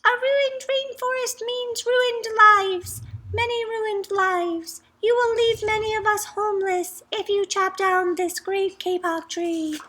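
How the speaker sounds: tiny frog piping into the man's ear; squeaky tree frog. A narrator's reading. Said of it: "In a squeaky voice, he piped".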